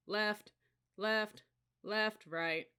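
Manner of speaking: lightly voiced marching cadence; drill cadence call. A narrator's reading. Sergeant calling step to marching cadets, cadence call. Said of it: "he called".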